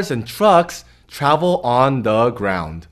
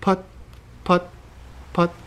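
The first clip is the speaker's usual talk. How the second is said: puttering motor; 4 semitones higher, 7 dB quieter than usual. Acted. Boat motor sound effect.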